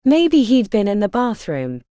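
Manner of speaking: usual speaking voice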